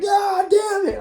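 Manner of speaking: very high startled shout. Retold or imitated. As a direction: yell it as the cat jumps out. Cat bolts up in dark.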